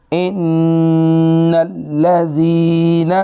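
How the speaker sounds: very slow, high, chanted tajweed; slow melodic Quran reading; Quranic recitation. Shown as a demonstration. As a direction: recite ان الذین with ghunna and both shaddas. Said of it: "اب اس کو ملا کے میں پڑھ رہا ہوں".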